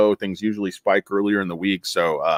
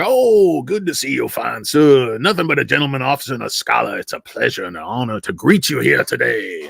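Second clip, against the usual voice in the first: put-on Southern accent; 3 semitones higher than usual. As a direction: lay on a genteel Southern accent. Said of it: "people down south could be like".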